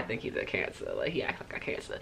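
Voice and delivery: soft whisper, barely voiced; whispered aside. Real, not acted. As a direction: let it slip out as a secret. Confiding aside about boyfriend.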